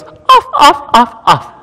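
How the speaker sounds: put-on high child voice counting off the knobs; little boy voice. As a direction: mimic a small boy checking the stove. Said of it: "and he goes".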